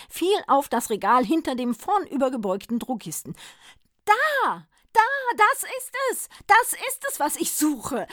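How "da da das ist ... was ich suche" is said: high, loud, eager discovery; excited Santa Claus. A narrator's reading. Santa spotting the shelf, high and eager. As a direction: Santa thrilled to find it.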